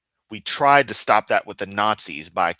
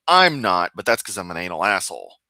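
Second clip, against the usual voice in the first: normal talking voice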